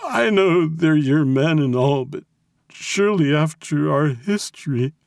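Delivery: high, faltering, tearful plea; pleading captive. A narrator's reading. Kidnapped businessman appeals to mob boss, pleading.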